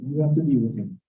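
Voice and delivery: low, falling official answer; acted low-pitched matter-of-fact reply; deadpan clerk. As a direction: echo it back as an unmoved clerk. Clerk echoes the customer's question.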